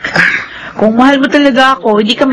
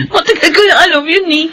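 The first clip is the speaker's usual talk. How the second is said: ordinary delivery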